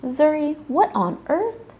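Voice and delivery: high-pitched, alarmed questioning; startled question. A narrator's reading. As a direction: react to a crash, voice jumping up. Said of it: "he asked".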